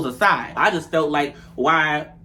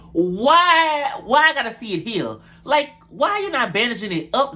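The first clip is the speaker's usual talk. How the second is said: exasperated high why; 6 semitones higher than usual. Acted. Scolding Jayla about the wound.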